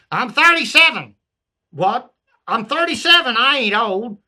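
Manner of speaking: put-on Southern accent, high and indignant; Southern yokel protesting his age; Southern peasant. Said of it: "I changed it to a Southern".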